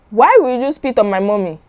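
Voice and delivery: little daughter protecting her mommy, a hint of a tiny voice; tiny child voice. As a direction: voice a little girl asking why, mildly. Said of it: "She had that tiny voice".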